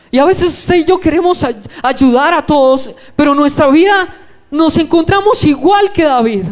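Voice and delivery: preaching with lifted pitch, pressing each question; fervent preaching. Acted. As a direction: plead with the congregation like a pastor in full flow. Preacher opening with raised voice.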